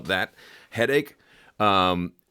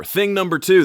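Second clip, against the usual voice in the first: excited announcement; 10 semitones higher, 7 dB louder than usual. Real, not acted. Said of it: "announcing section".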